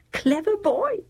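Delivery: high-pitched, delighted praise; pleased mother's voice; praising mother. Acted. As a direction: praise your boy in a high lilt. Mother praises scheming son.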